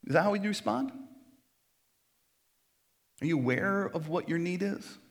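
ordinary delivery

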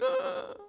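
very high drawn-out "ah"; hammed-up high squeal; mock wail. Person being yelled at in class, mock wail.

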